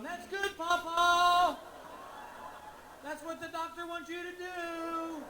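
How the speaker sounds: high, sing-song praise through a curtain; high cooing encourager. Retold or imitated. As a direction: coo it like praising a toddler. Unseen man coaching a farting patient.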